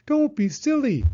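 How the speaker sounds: high, loud owl voice; a bossy owl brushing it off; Owl's voice. A narrator's reading. Owl dismissing Hedgehog's offer, high and loud. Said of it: "said Owl".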